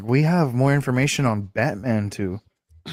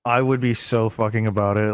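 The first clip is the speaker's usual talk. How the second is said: usual speaking voice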